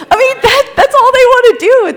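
high-pitched laughing voice. Real, not acted. Mother laughing about kids at photo time, high and laughing. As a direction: laugh through it, voice going way up. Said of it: "laughs while speaking".

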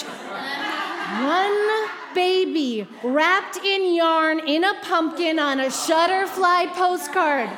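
high, loud, stretched-out comic rant; worked-up, emphatic protest held high; exasperated insistence. Acted. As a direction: belt it out in mock outrage, dragging the first words. Worked-up repeat of her wish.

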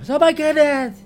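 campy mock falsetto exclamation. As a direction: swoon it out in falsetto. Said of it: "my universal female impersonation".

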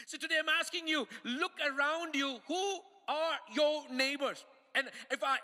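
preaching at full force. Acted. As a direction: push each line to the back row.

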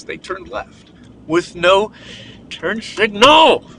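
slow, disbelieving, rising to turn signal; incredulous road rage; exasperated rant. Real, not acted. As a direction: drag it out in outrage. Angry driving rant.

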